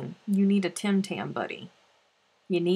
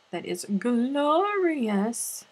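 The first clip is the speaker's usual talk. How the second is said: slow, higher, savoring; drawn out; 5 semitones higher, much slower than usual. Real, not acted. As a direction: stretch it out dreamily. Savoring the cookie idea.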